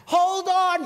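high, loud yell through the storm; shouted warning. Acted. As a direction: call out over crashing waves. Acting out shouts amid huge waves.

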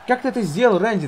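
high, loud disbelief; surprised. Real, not acted. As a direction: exclaim in disbelief. Opponent reverses unexpectedly.

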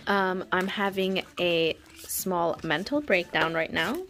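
ordinary delivery

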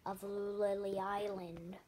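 ordinary talk

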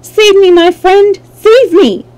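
high, loud plea to be saved; pleading girl's cry, pitched high and loud; crying for help. A narrator's reading. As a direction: call out urgently to a friend for rescue. Girl locked up cries for help.

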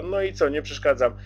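usual speaking voice

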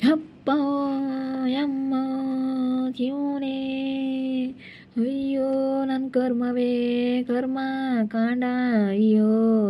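high, slow drawn-out lament; mock wailing. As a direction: wail to the heavens at the doorstep. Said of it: "ಅಳೋದು, ಕರಿಯೋದು". (ನಾಟಕೀಯ ಗೋಳಾಟ)

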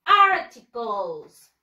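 articles said slowly and loudly as AR-ti-kols; slow, loud model of articles for the kids to echo; model pronunciation. Shown as a demonstration. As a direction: drill word articles, each syllable clear. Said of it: "One more time".

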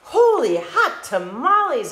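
high, loud mock exclamation; exclaiming. Skippy shooting past his reflection, mock exclaiming loudly. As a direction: shout it out in playful surprise. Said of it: "exclaimed Skippy John".

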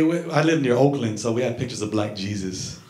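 normal talking voice

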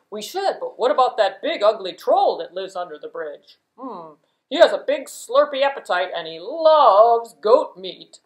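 loud worried big brother goat. Acted. Big goat warning about the troll, loud ominous goat voice.

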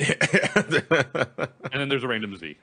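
laughing. Real, not acted. Laughing at random Z rating.

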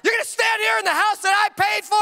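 high, pompous tirade; dad ranting at his toddler over milk; mock rant. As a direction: puff up with righteous outrage.

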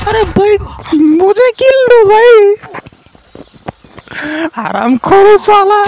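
high, slow, whining plea to his friend; whiny high complaint. Real, not acted. Teammate steals kill.

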